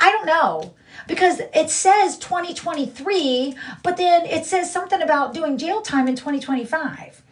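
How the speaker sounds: a hint of a clueless woman's voice; mocking her rival. Mimicking the rival reading her court record, mildly mocking. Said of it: "and then she's like".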